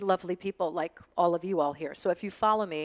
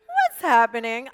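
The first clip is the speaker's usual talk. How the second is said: slow, louder, drawn-out rising question; mock baffled American; 4 semitones higher, 7 dB louder, slower than usual. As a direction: play the lost viewer who understands nothing. Mocking confused Americans.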